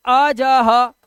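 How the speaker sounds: अजह loud and drawn out, every vowel stretched; three letters joined. Shown as a demonstration. Teacher mocking up the mistaken grouping, three letters joined. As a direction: punch out अजह slowly as आ-जा-हा. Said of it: "अगर आप ये यहां तीन जोड़ लिया".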